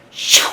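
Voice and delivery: loud imitated swish of a golf ball; whooshing shot noise. Coach imitating the kid's first drive, explosive whoosh. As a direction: mouth the club whooshing through.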